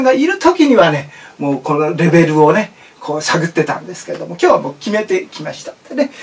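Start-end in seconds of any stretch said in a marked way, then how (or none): none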